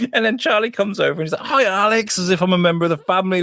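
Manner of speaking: high, quick excited storytelling; excited retelling. Retold or imitated. Friend retelling Charlie greeting him, high excited rush. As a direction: rush it out, thrilled.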